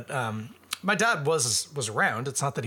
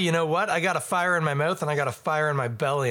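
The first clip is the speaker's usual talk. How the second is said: usual speaking voice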